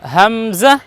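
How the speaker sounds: হামজা said ham-za, with a buzzed z; buzzing z sound. Shown as a demonstration. Teacher corrects the j version, হামজা with z. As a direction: put a clear z at the end of হামজা. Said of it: "জা বর্ণ লাগাবো".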